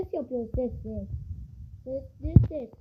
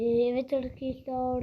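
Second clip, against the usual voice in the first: ordinary talk, nothing marked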